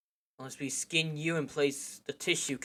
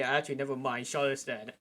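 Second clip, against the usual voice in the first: ordinary delivery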